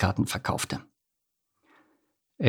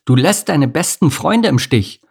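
higher, earnest reproach; serious reproach; 7 semitones higher, 4 dB louder than usual. A narrator's reading. Ant reproaching Bodo, serious. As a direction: hold him to account. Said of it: "sagte Amelie ernst".

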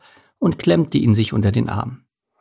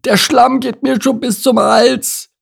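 high, strained cry for help; alarmed, high-pitched character voice; distressed cry; 4 dB louder than usual. A narrator's reading. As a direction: call out high as if sinking fast. Hare sinking in the bog cries out.

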